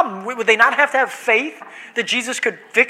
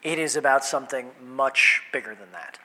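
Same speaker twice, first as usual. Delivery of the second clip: ordinary delivery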